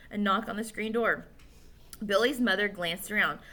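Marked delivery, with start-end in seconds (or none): none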